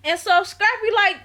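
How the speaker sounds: ordinary delivery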